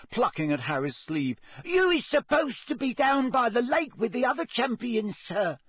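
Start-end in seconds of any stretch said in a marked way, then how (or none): 1.7-5.6 squeaky, high-pitched house-elf urging; Dobby. A narrator's reading. Elf waking Harry in the library, squeaking urgently. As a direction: squeak it urgently, far above normal pitch. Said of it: "squeaked Dobby".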